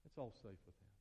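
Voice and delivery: gentle hushed echo; quiet. Acted. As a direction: whisper the promise home. Softer repeat.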